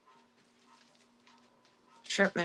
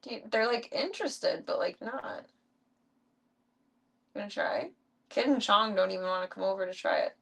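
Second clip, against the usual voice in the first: ordinary delivery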